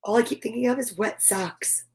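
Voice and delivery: plain reading voice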